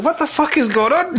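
high-pitched laughing disbelief; baffled. Retold or imitated. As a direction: squeal it in exasperated confusion. Acting own baffled reaction.

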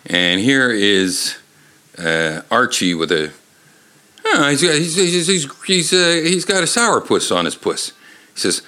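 usual speaking voice

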